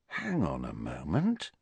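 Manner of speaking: softly puzzled Harry; slow. A narrator's reading. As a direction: drop the voice and slow down on it. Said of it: "he said slowly".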